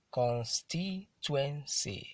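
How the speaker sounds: "constituency" very slow, in four separate beats; syllable by syllable. Shown as a demonstration. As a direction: sound out "constituency" with a gap after each syllable. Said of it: "That's a four-syllable word".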